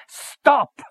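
high, loud furious shout; angry shout. Acted. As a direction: bark it at the child in anger. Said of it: "he shouted".

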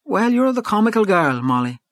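high-pitched, frank exclamation; a frank, lilting verdict; frank remark. A narrator's reading. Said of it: "said Missus Conroy frankly".

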